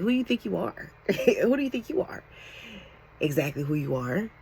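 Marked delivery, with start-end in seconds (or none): 0.0-2.2 high, hammed-up offended challenge; mock outrage with a laugh; mock indignant critics. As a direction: mimic haters scoffing, pitched up. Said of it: "They're gonna be mad".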